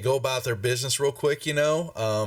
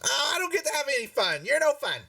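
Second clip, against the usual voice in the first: put-on high, quick child's complaint; little boy; faster than usual. Little boy protests to his mom, high petulant whine. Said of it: "he's the little boy".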